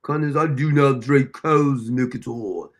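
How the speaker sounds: low, slow mock-British primness; mock proper British. Host imitating Clever refusing milk, mock-proper British accent. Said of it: "she talks real proper when she wants".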